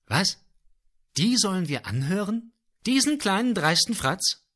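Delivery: viel höher, entrüstet ausgerufen; ungläubig und empört; empört überrascht. A narrator's reading. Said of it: "Überrascht schauten sich die Orchesterinstrumente an". (Instrumente staunen entrüstet über die Flöte, viel höher)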